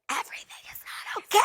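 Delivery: high, strained whisper; panicked mock whisper. Gymnast admitting the truth behind "I'm okay", panicked whisper. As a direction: whisper it high, like a secret alarm.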